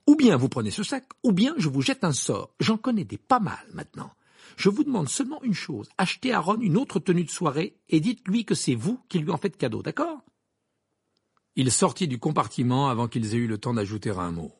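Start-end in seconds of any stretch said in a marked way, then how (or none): 0.1-10.2 high-pitched brisk ultimatum; curt. Acted. Harry giving the twins orders, curt. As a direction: lay down terms sharply. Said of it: "dit-il sèchement".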